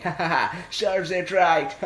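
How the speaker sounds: acted crowd jeering with laughs; mocking laughter. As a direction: laugh it out at someone's expense. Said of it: "everybody's laughing".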